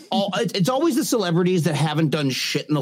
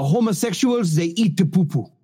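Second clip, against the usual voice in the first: Kenyan minister; 3 semitones higher than usual. Retold or imitated. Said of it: "the Kenyan minister that was like".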